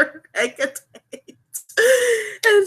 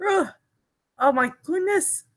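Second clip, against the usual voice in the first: normal talking voice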